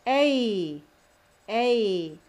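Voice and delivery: A loud and drawn out as ay; long vowel sound. Shown as a demonstration. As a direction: stretch A into a long, falling ay. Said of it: "It sounds as A or E".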